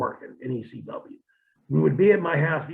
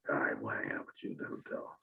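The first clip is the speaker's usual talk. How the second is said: hushed reply; 16 dB quieter than usual. Retold or imitated. Mock hushed agreement.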